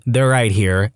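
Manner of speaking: plain reading voice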